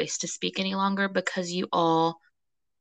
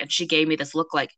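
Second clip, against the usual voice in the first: ordinary talk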